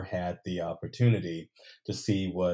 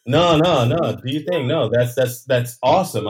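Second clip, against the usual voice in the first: excited; 6 semitones higher, 12 dB louder than usual. Real, not acted. Overjoyed at the release news.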